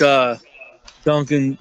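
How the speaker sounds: usual speaking voice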